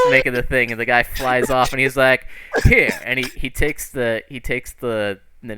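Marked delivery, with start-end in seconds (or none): 2.6-3.0 loud, higher, curt toss-off; acted irritated handover. Character tosses pancake at man.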